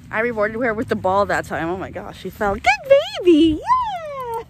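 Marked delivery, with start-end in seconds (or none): 2.5-4.4 high, louder praise voice for the dog; excited dog praise. Real, not acted. Praising dog after she fell.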